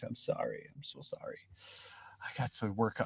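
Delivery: tiny unvoiced whisper, fading away; sheepish, barely audible apology. Real, not acted. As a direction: whisper sorry after an outburst. Hushed apology after yelling too much.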